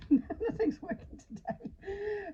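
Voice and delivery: high, slow words broken up by laughter; laughing exasperation. Real, not acted. Teacher whose pen has failed, laughing exasperation. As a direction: giggle helplessly through the words.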